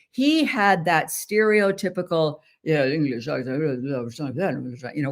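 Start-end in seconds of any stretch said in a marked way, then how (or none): 2.6-5.1 a hint of upper-class English, lips barely moving; mumbled posh English. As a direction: lightly mimic a stiff English accent with almost closed lips. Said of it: "where he barely moved his lips".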